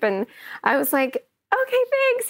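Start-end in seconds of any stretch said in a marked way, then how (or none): 1.5-2.3 tiny, very high imitated reply; starstruck meekness. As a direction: squeak out a dazed thanks. Retold starstruck reply to star.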